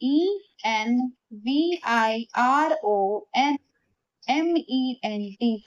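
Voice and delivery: slow letter-by-letter spelling; spelled out slowly. Shown as a demonstration. Teacher to students, spelling letter by letter slowly. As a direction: dictate each letter slowly to the class. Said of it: "सही होगा हमारा environment".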